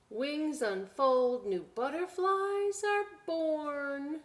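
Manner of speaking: high, slow, marvelling read-aloud; storybook reading. Acted. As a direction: unfold the words slowly like wings. Reading picture book aloud.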